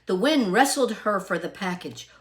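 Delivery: plain reading voice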